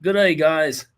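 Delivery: attempted Aussie greeting, a bit put on; Australian accent. Host reading listener email in Australian accent. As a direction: play an Aussie host saying hello. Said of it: "in an Australian accent".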